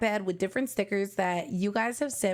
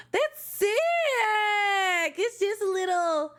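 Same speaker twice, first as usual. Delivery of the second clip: much higher, a burst of thrill; excited; 10 semitones higher than usual. Real, not acted. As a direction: blurt it out thrilled. Reacts to unboxed album item.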